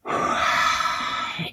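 long unvoiced sigh; heavy sigh. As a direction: let out a long tired breath. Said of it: "deep breath to rein back her temper".

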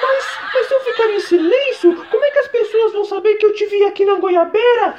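high, pleading woman's voice; high-pitched Damares voice. Acted.

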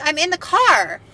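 retold protest, pitched high. Driver arguing with the officer over a ticket, protesting.

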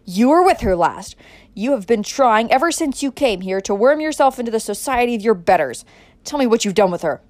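loud, raging tirade; furious father berating a suspect; out-of-control anger. A narrator's reading. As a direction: hurl accusations at full voice. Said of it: "losing all control of himself".

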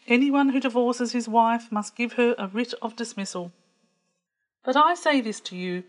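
ordinary delivery

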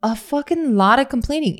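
normal talking voice

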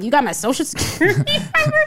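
high, fast, laughing disbelief; can't believe she knows this, laughing; incredulous laugh. Retold or imitated. Hearing a stranger recite her social security number, high laughing disbelief.

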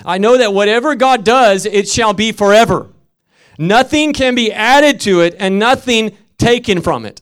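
loud, high scripture reading; scripture read aloud. Shown as a demonstration. Preacher reading Ecclesiastes to the congregation, loud. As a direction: read the verse out to the church. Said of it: "I just want to say that again".

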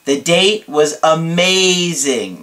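"amazing" slowly stretched in the middle; gush, holding "amazing" long; drawn-out amazing. Retold or imitated. Client reporting his date, "amazing" dramatically drawn out. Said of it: "That's how he puts it".

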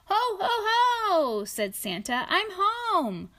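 high, loud ho ho ho; jolly Santa. Acted. Reading to a child, Santa joyfully announcing he's home. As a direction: boom a jolly Santa laugh.

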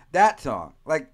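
ordinary talk

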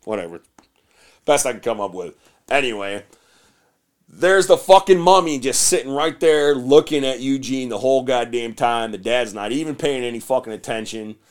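normal talking voice

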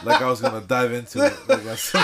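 giggling through words; laughing while talking. Real, not acted.

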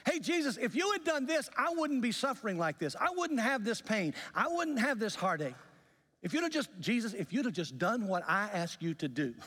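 high, insistent pleading reproach; acted complaint. Suffering believer blaming Jesus for not answering, high-pitched acted complaint. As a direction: pile up the accusations, pitched well above normal.

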